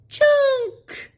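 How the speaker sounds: strained high squeak of effort; high, loud straining cry. Acted. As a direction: push the word out like lifting something heavy. Little E straining to lift junk.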